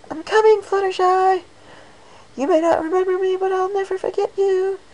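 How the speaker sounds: very high, loud cheerful yell; yelling Pinkie. Acted. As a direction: call out across the field.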